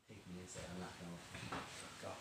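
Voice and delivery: very low, hushed plea; subdued acted entreaty; quiet self-offering. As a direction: insist softly and gravely. Retold brother offers himself.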